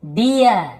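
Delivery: डियर stressed as di-yar; ईयर ending. Shown as a demonstration. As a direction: model डियर loudly in two syllables. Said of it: "ईयर ऐसे पढ़ते हैं". (डियर में ईयर खींचकर)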